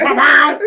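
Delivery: high, loud double swoosh; imitated lightsaber swings. Joking about Darth Maul's moves, imitated lightsaber noise. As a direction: mouth two saber swipes.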